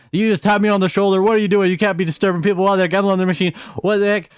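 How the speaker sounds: high, pressured mock yell, barely pausing; angry yelling. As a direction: mimic a man indignantly telling a kid off. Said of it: "he is angry. He's yelling".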